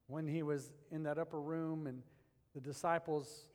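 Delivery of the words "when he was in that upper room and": normal talking voice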